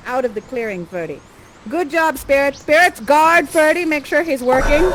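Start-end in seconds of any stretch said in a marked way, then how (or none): none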